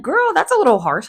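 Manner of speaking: high-pitched sassy comeback. Pretend. Imagined reply to Heather, a high sassy tease. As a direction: tease her lightly.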